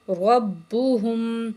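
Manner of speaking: ربہم with a thick ر joined to ب; heavy ra. Shown as a demonstration. Teacher demonstrates the heavy ra, slow recitation. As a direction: roll a heavy ر straight into ب in ربہم. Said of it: "را کو موٹا کریں گے".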